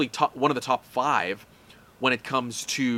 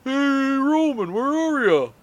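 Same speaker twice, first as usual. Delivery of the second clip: high nasal comic impression; high-pitched celebrity impression; Ray Romano impression; 10 semitones higher, slower than usual. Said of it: "kind of a weak impression".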